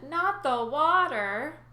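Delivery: slow, loud, high hammed-up begging; overacted fear of water; fake pleading. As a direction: drag it out like fake dread. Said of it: "my plan worked".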